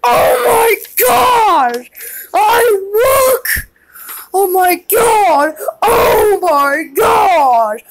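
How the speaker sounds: high, drawn-out wails of alarm; freaked-out hollering; panicked yelling. Acted. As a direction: cry out in horror, stretching the words. Reacts in panic to hook.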